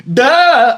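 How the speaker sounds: loud, drawn-out dopey yelp; odd falling yelp. Retold or imitated. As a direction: blurt out a long, odd cry. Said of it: "why I made that sound".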